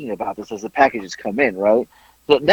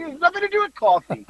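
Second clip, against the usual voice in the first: high mock protest; 4 dB quieter than usual. Retold denial, high voice.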